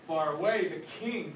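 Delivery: ordinary delivery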